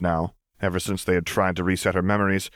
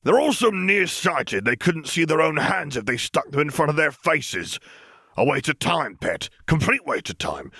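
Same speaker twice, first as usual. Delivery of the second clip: higher, blustering dismissive rant; Uncle Vernon ranting; 9 semitones higher than usual. Acted. Vernon rants to Petunia about gifted school, blustering and scornful. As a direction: scoff at the whole idea.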